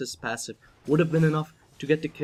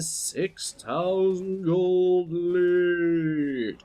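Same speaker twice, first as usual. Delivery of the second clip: slow, drawn out; 5 semitones higher, much slower than usual. Shown as a demonstration. Caster restating the gold lead after a slip, slow and drawn out. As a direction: stretch "giving them a six thousand gold lead" out very slowly.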